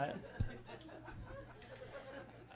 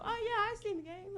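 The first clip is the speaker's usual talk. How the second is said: high, put-on casual bro voice, much higher; fake bro voice. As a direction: pretend to know sports, overplaying it. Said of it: "I just pretend and go".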